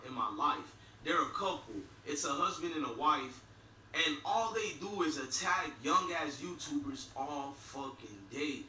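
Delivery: usual speaking voice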